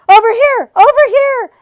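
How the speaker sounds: high loud shouted directions; loud beckoning call. A narrator's reading. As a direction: call them across. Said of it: "advised the voice".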